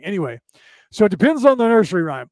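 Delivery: usual speaking voice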